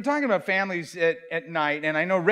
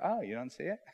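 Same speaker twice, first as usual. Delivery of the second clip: mocking; 5 semitones lower, 13 dB quieter, faster than usual. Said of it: "mocking other people".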